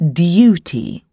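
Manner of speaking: "duty" with U said like "you". Shown as a demonstration. Reader teaching the Queen's English, "duty" with a "you" sound. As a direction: say "duty" as "dyoo-tee". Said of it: "should sound like the word U".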